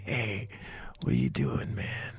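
soft, hushed call from a cell; hushed character voice. Acted. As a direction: whisper it from behind the bars. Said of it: "He's like".

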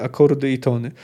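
usual speaking voice

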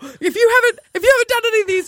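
high frantic begging; panicked pleading. Retold or imitated. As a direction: rush the apology in a panic. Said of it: "panicked retold texts, high pitch".

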